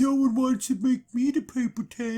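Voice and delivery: put-on lightly mope like a left-out kid; mopey Eeyore. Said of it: "Elron here is like".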